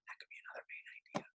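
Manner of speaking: soft voiceless whisper; whispered aside. Real, not acted. Teacher whispering a hint to students, softly. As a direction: let the class in on a quiet hint.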